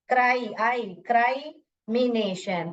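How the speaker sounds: क्राइमिनेशन with "cry" in it; slow क्राई-मिनेशन, long i; long I sound. Shown as a demonstration. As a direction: stretch क्राई to rhyme with "eye". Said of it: "यहाँ पर long हुआ".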